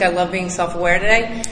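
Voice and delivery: ordinary talk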